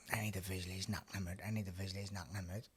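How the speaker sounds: soft, low mock chant; mock self-talk. Mocking fighters who recite visualisation. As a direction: parody an over-earnest fighter. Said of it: "going like".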